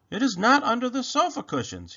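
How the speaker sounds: high, exclaimed protest; crying out. A narrator's reading. Rabbit searches under the cushions, high-pitched cry. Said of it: "he cried".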